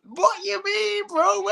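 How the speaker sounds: put-on very high, slow, dopey drunk voice; mate drunk in the nightclub, high and slow. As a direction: mimic a befuddled drunk pal. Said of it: "He's gone".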